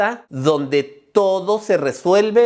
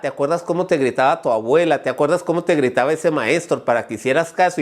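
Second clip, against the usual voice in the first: ordinary talk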